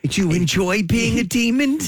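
a hint of a Philly sitcom lady; Susan impression. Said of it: "you know who Blanche sounds like?".

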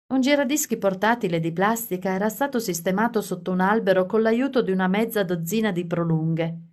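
ordinary delivery

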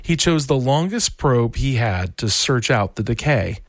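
ordinary delivery, nothing marked

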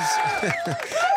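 high, delighted burst of a laugh; high squeal of a laugh; giddy laugh. Real, not acted. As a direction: let a thrilled giggle break out. Giddy laugh about the grandchild.

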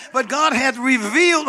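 preacher underlining "revealed" for the church; stress on revealed. Shown as a demonstration. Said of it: "underline the word revealed".

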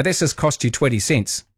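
ordinary talk, nothing marked

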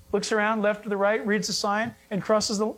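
usual speaking voice

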